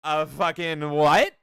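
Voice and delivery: loud, slow disbelieving "a fucking what"; loud incredulous shout; shocked outburst. Retold or imitated. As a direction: blurt it out in stunned disbelief. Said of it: "I went".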